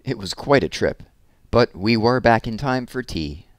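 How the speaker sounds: lively picture-book read-aloud, big expressive swings; reading to children. A narrator's reading. Teacher reading a balloon story to children, animated storytelling. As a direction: read it like story time for little kids.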